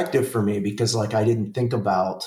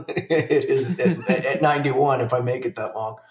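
laughing; 5 semitones higher than usual. Real, not acted. Joking about old neighbor's agility.

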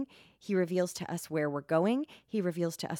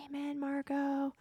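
mock audience reply; 8 semitones higher, 5 dB quieter than usual. Voices audience answering her amen.

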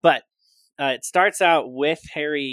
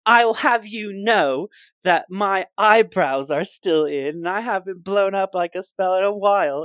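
indignant Seamus; 7 semitones higher, 9 dB louder than usual. Retold or imitated. S0 voicing Seamus defending his spells, higher indignant voice. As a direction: puff up with wounded pride.

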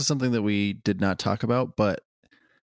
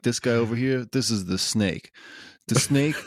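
Goodfellas mobster; 2 semitones higher than usual. Retold or imitated. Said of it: "He's like".

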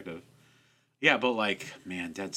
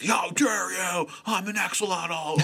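high, slow, hammed-up indignant shout; mock mad; 7 semitones higher, slower than usual. Voicing a game's axolotl getting mad, mock outrage. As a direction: play an outraged game creature, stretching the words. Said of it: "he's getting mad. He's just like".

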